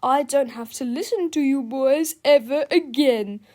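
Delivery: laughing principal. A narrator's reading. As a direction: dismiss them with mocking glee. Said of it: "laughed Mister Krupp".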